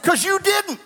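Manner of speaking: high mocking comeback at the dad; retorting quip. Teasing a stranger's angry father, mock high retort. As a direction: toss it back with a mock high snap. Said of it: "I said".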